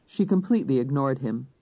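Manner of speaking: ordinary delivery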